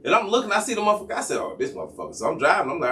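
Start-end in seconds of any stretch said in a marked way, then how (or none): 1.4-2.2 low, soft grumble; quietly fed up; annoyed mutter. Retold or imitated. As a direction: grumble it under your breath. Muttering about the tailing cop.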